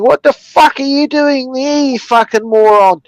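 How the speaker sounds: mock angry trucker shouting down; a touch of road rage from the cab; trucker yelling. Truck driver yelling down at him, mock angry. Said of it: "He's looking down at me going".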